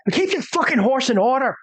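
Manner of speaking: high, indignant scolding with a disgusted "Ah!"; angry townswoman. Acted. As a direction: snap the order in a high character voice. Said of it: "she kinda, she just".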